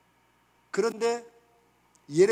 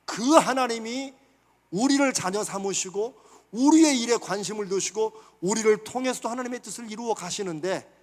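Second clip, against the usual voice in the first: normal talking voice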